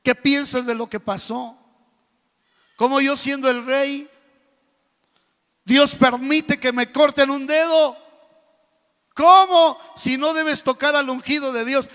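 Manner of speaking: loud, high, furious royal demand; king shouting at his servant, furious; angry king. Acted. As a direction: rage at the servant over the lost finger. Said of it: "este hombre muy enojado le dijo al siervo".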